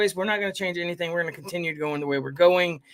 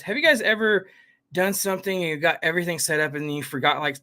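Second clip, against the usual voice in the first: ordinary delivery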